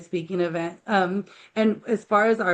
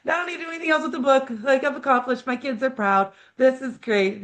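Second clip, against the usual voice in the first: much higher, elated cry of joy settling down; thrilled reply; 6 semitones higher than usual. Retold or imitated. Mom retelling her happy answer to her son, excited. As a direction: burst with joy, then calm to satisfied. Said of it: "And I'm like".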